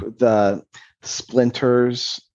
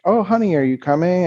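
lightly voiced husband, raised pitch on "honey"; husband calling; 6 semitones higher, faster than usual. Husband calls to wife from the bedroom, lightly imitated casual call.

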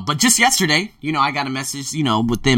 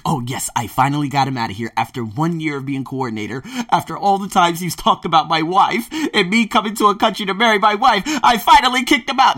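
giddy gloating; 3 semitones higher than usual. Acted. Coordinator gloating that he finally kicked a teacher out, giddy. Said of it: "he is just so giddy".

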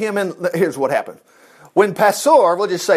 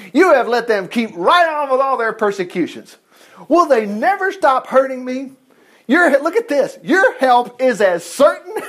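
higher, whining complaint to God; whiny complaint; 6 semitones higher than usual. Retold or imitated. Preacher voicing Jeremiah's grumbling complaint to God, whiny. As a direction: play Jeremiah griping at the Lord.

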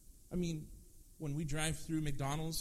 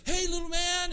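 much higher, louder sing-song daddy voice; baby talk; 9 dB louder than usual. Retold or imitated. Dad greeting his baby son on the phone in high baby talk. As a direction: coo it up high to a toddler. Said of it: "he's talking to him like that".

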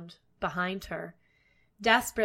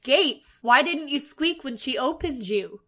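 high, loud storybook witch shriek; raised, angry witch's cry; 5 semitones higher, 5 dB louder than usual. A narrator's reading. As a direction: shriek the accusation, starting loudest. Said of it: "she shrieked".

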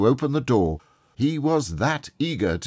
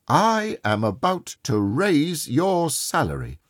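higher, ringing proclamation; spring the good news triumphantly; jubilant announcement; 5 semitones higher than usual. Acted. Scrooge springs the happy announcement.

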